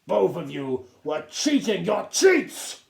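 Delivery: stern captain accusing; angry accusation. A narrator's reading. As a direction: condemn them both.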